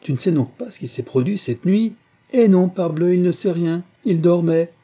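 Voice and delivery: high, loud exasperated outburst; exclaiming. A narrator's reading. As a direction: cry out in disbelief. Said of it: "s'écria le père Calcaire".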